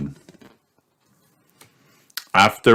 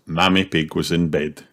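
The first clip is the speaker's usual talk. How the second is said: ordinary delivery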